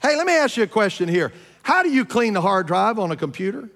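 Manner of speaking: ordinary talk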